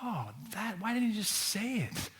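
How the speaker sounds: high, soft dawning realization; a hushed, high aha moment; sudden realization. Retold or imitated. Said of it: "you go like".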